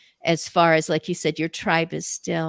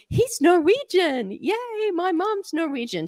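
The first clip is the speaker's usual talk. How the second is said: high excited squeal of delight; recalling her joy at the news, high and delighted; 10 semitones higher than usual. Retold or imitated. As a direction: gush it, thrilled to find a fellow Norwegian. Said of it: "And I'm going".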